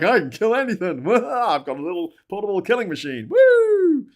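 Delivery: mock trigger-happy gloating, high and loud; excited braggart ending in a shrieked whoop; gun-toting American. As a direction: crow like a giddy gun nut, laughing. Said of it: "'Cause they're like".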